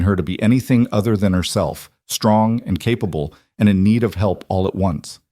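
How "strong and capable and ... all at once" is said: plain reading voice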